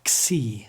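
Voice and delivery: "Xi" as "ksee", k running into s; KS combination. Shown as a demonstration. Teacher shows the sound, crisp ks xi. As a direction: hit both k and s in "Xi". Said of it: "this combination K S".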